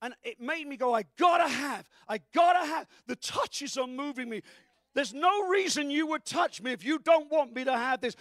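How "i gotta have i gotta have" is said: loud, hungry cry; desperate shout. Retold or imitated. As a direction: cry out with longing. Retelling being hit by a wave.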